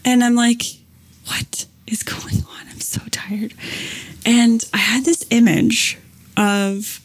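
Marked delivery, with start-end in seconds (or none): 1.3-3.5 hushed, breathy disbelief, drained; exhausted whisper. Retold or imitated. Telling friend about lying awake sleepless, exhausted whisper. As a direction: whisper it like a worn-out thought.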